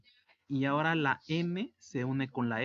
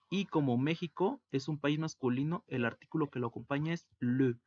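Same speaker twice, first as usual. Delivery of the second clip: ordinary talk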